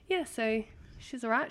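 ordinary talk, nothing marked

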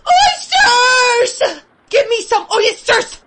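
high, loud hungry shout; starving boy crying out for oysters; desperate cry. A narrator's reading. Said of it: "was the cry that broke from me".

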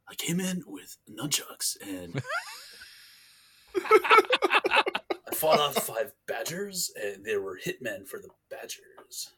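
drawn-out, high, slow Napoleon Dynamite drone. Retold or imitated. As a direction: stretch every word slowly like Napoleon Dynamite. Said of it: "Napoleon Dynamite would totally sound".

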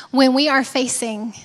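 usual speaking voice

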